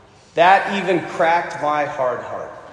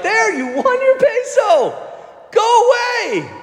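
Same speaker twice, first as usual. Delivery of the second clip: high, louder fed-up yell; exasperated shout; 4 dB louder, slower than usual. Retold or imitated. Man telling the girl to leave, high mock yell. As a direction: shoo her off, half joking.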